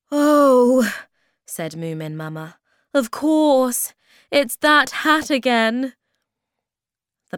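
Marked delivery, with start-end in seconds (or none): 0.1-0.9 loud, high "oh" of surprise; sudden realisation. A narrator's reading. Moomin Mama sees the jungle indoors, surprised exclamation. 2.9-6.0 high, loud exclamation; sudden realisation. A narrator's reading. Moomin Mama realises the hat caused it, loud exclamation.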